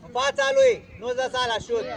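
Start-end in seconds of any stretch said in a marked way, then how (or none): 0.0-0.8 high, loud sideline shout; sharp raised coaching call; calling instructions. Real, not acted. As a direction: yell the order across the field.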